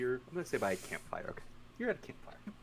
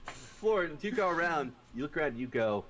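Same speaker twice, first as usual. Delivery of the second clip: normal talking voice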